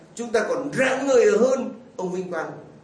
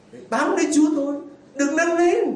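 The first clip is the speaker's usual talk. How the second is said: high, fast urging; fervent preacher's urging; emphatic exhortation; 8 semitones higher than usual. Real, not acted. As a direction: drive it home with fire.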